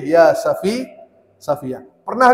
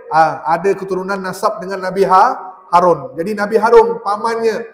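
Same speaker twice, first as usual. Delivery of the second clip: usual speaking voice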